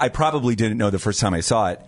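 ordinary talk

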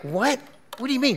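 high, baffled questioning; incredulous. Acted. Esau reacting to an insane request, incredulous. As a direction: react in acted disbelief, pitch jumping up.

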